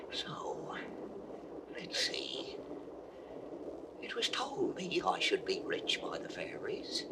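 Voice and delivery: secretive whisper; hushed whisper over hidden treasure. Acted. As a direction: breathe it to himself so nobody hears. Shepherd secretly opens the bundle.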